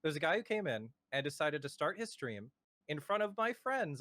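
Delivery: normal talking voice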